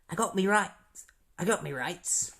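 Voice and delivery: put-on Australian accent; Captain Boomerang. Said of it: "make him sound like an Australian guy".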